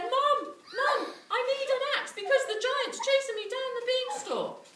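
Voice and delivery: put-on high, loud, fast panicking boy; panicked boy. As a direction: shout for help as frightened Jack. Jack panics to mum.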